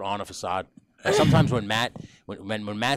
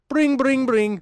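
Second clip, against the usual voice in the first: high, loud ringing noise; imitated phone ring; 8 dB louder, much slower than usual. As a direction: belt out a mock telephone ring. Role-play starts with phone ringing.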